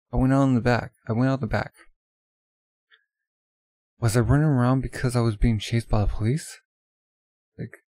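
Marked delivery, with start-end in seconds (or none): 0.1-6.6 louder, stunned; alarmed and loud; shocked realisation. Acted. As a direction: realise it was himself.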